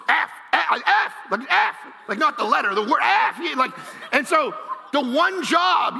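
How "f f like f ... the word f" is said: imitated Tourette's swearing tic. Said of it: "I used to do that".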